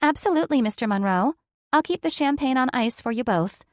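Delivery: high, loud, bright service voice; chirpy attendant answering the boss; flight attendant. A narrator's reading. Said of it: "the flight attendant".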